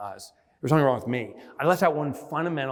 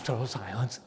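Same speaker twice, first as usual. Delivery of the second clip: usual speaking voice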